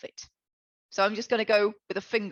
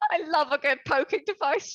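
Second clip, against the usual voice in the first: higher-pitched, through real giggles; laughing; 5 semitones higher than usual. Real, not acted. Player cracks up over the poking device, laughing. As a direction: say it while cracking up.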